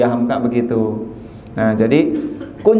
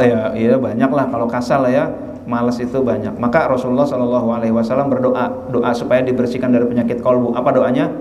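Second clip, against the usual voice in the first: ordinary delivery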